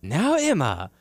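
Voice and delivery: high, scolding mock-boss lecture. Boss scolding employee over shoplifting, mock stern. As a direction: chide her like a strict manager. S1 voices Santino scolding Emma.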